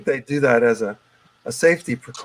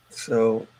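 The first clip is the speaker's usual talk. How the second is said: ordinary talk, nothing marked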